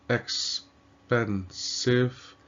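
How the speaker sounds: "expensive" split into three slow, separate syllables. Shown as a demonstration. Teacher showing a three-syllable word, syllable by syllable. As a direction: break it up: ex, pen, sive, with pauses. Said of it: "another example here of three syllables".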